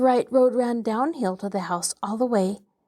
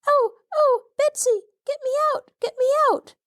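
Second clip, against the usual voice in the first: put-on much higher child voice, begging fast; panicked plea; faster than usual. A narrator's reading. Trapped child begging her cousin for rescue, panicked. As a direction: cry for help from the bottom of a pit.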